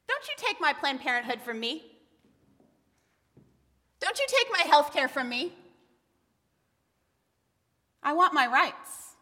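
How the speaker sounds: high, forceful angry demand; whole-body anger. Shown as a demonstration. Woman demanding her rights before congregation, angrily insistent. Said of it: "say it with my whole body".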